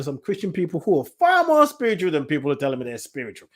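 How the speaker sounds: ordinary talk, nothing marked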